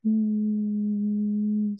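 a relaxed hum in the middle of the voice; a settled mid-range hum; natural hum. Shown as a demonstration. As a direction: hum easily at a comfortable pitch. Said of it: "humming in your natural voice".

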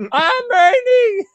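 high, loud, stretched-out mock shriek; imitated scream, far above normal pitch; Ernie's scream. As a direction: screech it like a desperate self-announcement. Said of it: "He's screaming".

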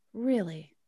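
low, soft, tightly contained anger; demonstrated icy restraint; controlled livid. Shown as a demonstration. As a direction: hold the fury down, quiet and low. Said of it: "My livid is like".

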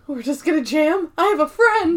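high-pitched gushing disbelief; gasping mock excitement, voice climbing; giddy delight. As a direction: imitate someone thrilled to be included. Acting out Andy's delight, after gasp.

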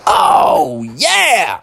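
big swooping "oh, yeah"; gleeful cheer, starting high and settling; thrilled to bits; delighted exclamation. Acted. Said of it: "And he said".

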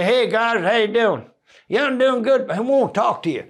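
high-pitched imitated Southern accent; put-on American accent. Comedian replying to the band off stage, high put-on American accent. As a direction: answer back in their American accent. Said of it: "so the accents have always been".

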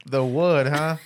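ordinary talk, nothing marked

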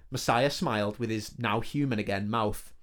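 usual speaking voice

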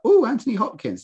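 high, swooping "Oh" on spotting Anthony Hopkins; mildly starstruck coo; pleasantly impressed. Retold or imitated. Viewer spotting a guest star in the titles, pleasantly impressed. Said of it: "guest stars where I've been like".

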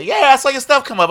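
high-pitched, upbeat mimicry of other people; imitated fan. Mimics people reporting seeing posts.

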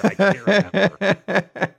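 real helpless laugh on the words. Real, not acted. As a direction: crack up while admitting confusion. Laughs at own confusion.

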